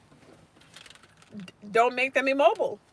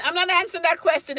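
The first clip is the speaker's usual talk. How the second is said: ordinary talk, nothing marked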